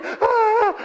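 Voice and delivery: short high panicked yelp; mock high shriek. Boy hearing his brother's spanking, high shriek. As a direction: shriek like a frightened kid.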